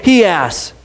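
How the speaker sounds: ordinary delivery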